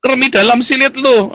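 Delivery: high, loud, drawn-out scoff; hammed-up divine put-down; God's mocking retort. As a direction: shout back a scornful answer from heaven. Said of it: "Tuhannya bilang".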